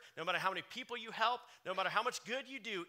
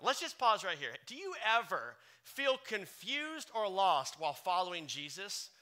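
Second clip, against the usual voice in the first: ordinary talk, nothing marked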